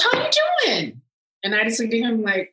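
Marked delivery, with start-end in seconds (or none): 0.0-1.0 very high, sing-out hello; acted effusive greeting. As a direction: imitate a big greeting, pitched way up.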